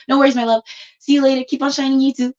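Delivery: high, loud, cheery goodbye; cheery farewell. Real, not acted. Signing off warmly to someone.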